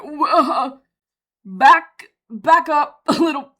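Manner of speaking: half-laughing alarm, calling a halt; startled protest. Retold or imitated. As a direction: stop them short, loud and taken aback. Reacting to parasite news.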